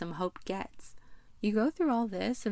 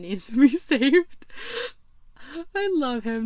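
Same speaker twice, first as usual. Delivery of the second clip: loud, laughing through the words; amused laughing; 5 semitones higher, 8 dB louder than usual. Real, not acted. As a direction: crack up while telling it. Amused by Hope falling off.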